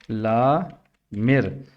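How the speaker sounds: लामिर stretched very slowly; slow modeled pronunciation; drawn-out word. Shown as a demonstration. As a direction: draw out लामिर, each sound held. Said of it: "एक है लामिर".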